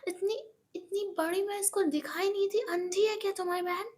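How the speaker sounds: high, sneering, mocking retort; scornful disbelief. Monika mocks Eva's excuse, high and scornful. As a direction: scoff at the excuse. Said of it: "Monika कहती".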